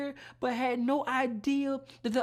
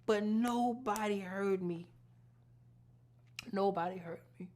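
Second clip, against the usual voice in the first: softer, sinking repetitions; grieving hush; 3 semitones lower, 7 dB quieter than usual. Real, not acted. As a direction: let it fall away in sorrow. Grief that no one listened.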